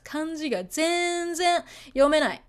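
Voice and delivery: "全然" stretched long and pitched high; emphasis on 全然. Shown as a demonstration. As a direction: hit "全然" hard, holding it out. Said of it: "You wanna put that much emphasis".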